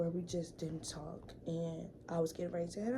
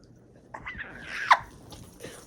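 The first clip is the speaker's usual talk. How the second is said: loud, high-pitched laugh; sudden high cackling laugh; burst of laughter; 7 dB louder than usual. Real, not acted. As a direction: crack up at your own story. Bursts out laughing at own story.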